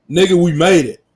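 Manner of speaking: loud triumphant yell; hype shout. Acted. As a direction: shout it like you just won.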